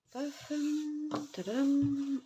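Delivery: playful reveal. Pretend. Reveal of finished lid.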